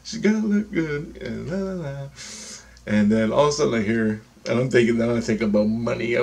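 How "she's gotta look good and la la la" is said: high put-on ego voice. Ego answering who to be with, high imitated voice. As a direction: mimic the ego in a high pitch. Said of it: "You know, the ego".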